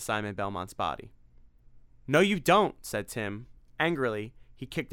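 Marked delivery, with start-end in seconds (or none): 2.1-2.8 loud high defiance; defiant cry; defiant shout. Acted. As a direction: refuse him fiercely. Tim resisting Dracula.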